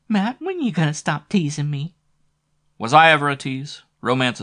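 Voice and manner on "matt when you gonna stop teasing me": high, pouting sultry woman; sexy pout. Acted. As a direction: pout it playfully. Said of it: "Flo's sexy voice".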